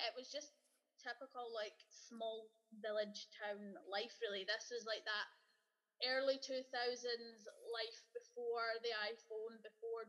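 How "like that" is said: ordinary talk, nothing marked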